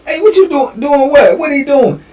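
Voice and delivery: puzzled cellmate. Retold or imitated. Cellmate turning round, puzzled.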